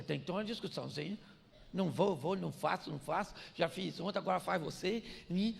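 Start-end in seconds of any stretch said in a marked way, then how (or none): 1.7-5.0 low, softer sulky mutter of refusal; grumbling refusal. Retold or imitated. Husband refusing his wife's chores, a grumbling sulk. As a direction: sulk it out, won't do it.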